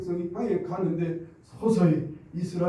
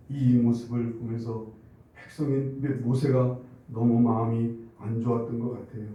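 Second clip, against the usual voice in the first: ordinary talk, nothing marked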